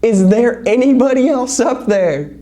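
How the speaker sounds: high, hopeful shout upward. Acted. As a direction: yell it to anyone else up there.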